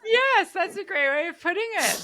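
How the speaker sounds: delighted, laughing and loud. Real, not acted. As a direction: say it through a big laugh. Delighted agreement while laughing.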